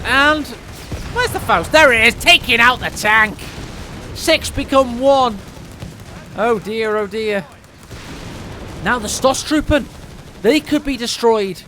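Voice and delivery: excited esports casting; live play-by-play. Acted. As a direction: call the match fast and hyped.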